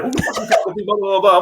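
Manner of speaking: high, heated confrontation; challenging the girlfriend's father; aggressive challenge. Retold or imitated. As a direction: come at him hard with the question. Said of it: "me being like".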